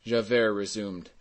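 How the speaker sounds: ordinary delivery, nothing marked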